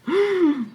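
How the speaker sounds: high, loud gasp of fright; sharp frightened gasp. A narrator's reading. Girl realises she left the bread behind, gasping. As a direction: catch your breath in alarm.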